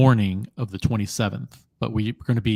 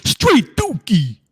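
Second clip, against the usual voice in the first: Reaper impression; 10 semitones higher, 5 dB louder, much slower than usual. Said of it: "something that Reaper would say".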